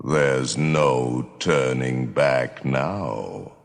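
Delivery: slow, ominous dark lord voice; menacing welcome to doomed guests. Acted. Spooky show welcome over music.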